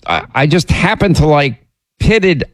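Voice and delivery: ordinary delivery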